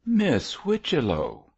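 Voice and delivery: high, affronted exclamation; outraged cry. A narrator's reading. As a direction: cry the name out in offence. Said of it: "cried the outraged spinster".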